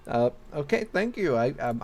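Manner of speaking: usual speaking voice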